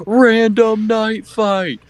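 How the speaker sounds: high, drawn-out shout to camera; mock announcer yell. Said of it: "look into the camera and yell".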